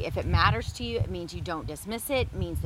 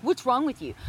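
louder, punching "What's" in "What's wrong with you?"; harsh accusing; 5 semitones higher, 4 dB louder than usual. Shown as a demonstration. Wife contrasting harsh and caring versions, harsh. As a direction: fire it off as a blame. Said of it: "I could say it like that".

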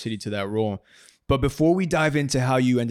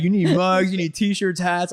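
excited; 6 semitones higher, 4 dB louder, slower than usual. Retold or imitated. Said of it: "you were like".

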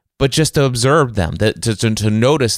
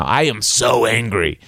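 acted anger. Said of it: "better emotional state for me".

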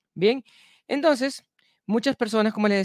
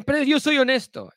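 defensive protest; 8 semitones higher, 4 dB louder than usual. Retold or imitated. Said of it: "y dice".

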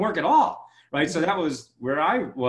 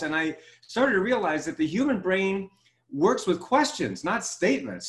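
ordinary talk

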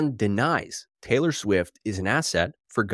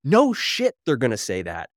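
ordinary talk, nothing marked

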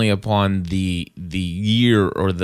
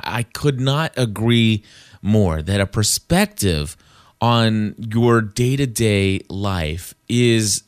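ordinary talk, nothing marked

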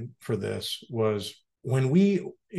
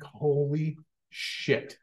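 stunned surprise; 3 semitones higher, 5 dB quieter, much slower than usual. Retold or imitated. Stunned reaction to the name.

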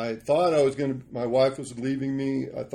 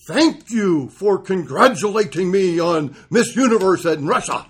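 broken English; 6 semitones higher, 11 dB louder than usual. Retold or imitated. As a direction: imitate the Russian controller's halting English. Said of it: "in broken English goes".

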